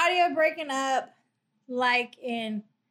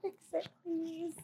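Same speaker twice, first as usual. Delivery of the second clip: whispering; 13 dB quieter than usual. Pretend. Playful helpless plea to partner.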